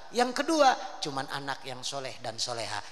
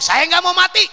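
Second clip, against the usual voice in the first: high, loud cry of refusal; loud pleading refusal; 16 dB louder than usual. Acted. Prophet Idris refusing the angel of death, loud and pleading. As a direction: beg loudly not to be taken. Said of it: "Nabi Idris ngomong".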